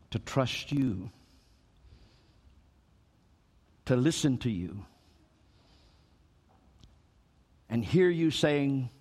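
soft, low, unhurried prayer voice. Acted. Pastor praying over the congregation at the close, soft and low. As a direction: pray gently over the people.

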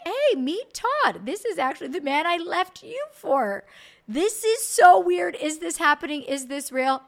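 high, loud, slow stunned introduction; nervous laugh-through disbelief; awkward disbelief. Retold or imitated.

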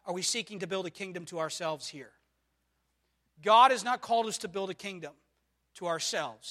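ordinary talk

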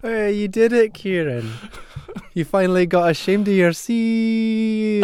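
high, loud crowing cheer; mock-triumphant congratulation. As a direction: crow it high, then stretch out the last word. Teasing congratulation echoing the song.

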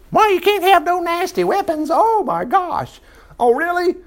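high squeaky scoffing voice; mock whiny objector. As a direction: play a silly complainer. Preacher mocks objector in silly voice.

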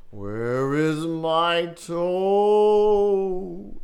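a drawn-out ghostly wail from downstairs; groaning ghost. Acted. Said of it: "it groaned".

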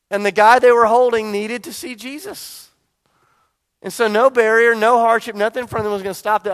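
loud, driving preacher's build; preaching at full force. Acted. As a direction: drive it home to the congregation.

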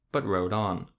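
ordinary delivery, nothing marked

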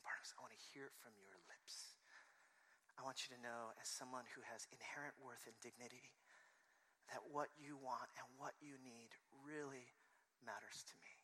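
soft, hushed, earnest address; quiet, intimate affirmation; tender reassurance. Acted. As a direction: speak gently, pausing before the last words. Voices Jesus reassuring Bartimaeus.

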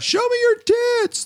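imitated yell, leaping very high in pitch; mock heckler yell. High school kid heckling from the boat, mock yell. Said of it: "he yells out".

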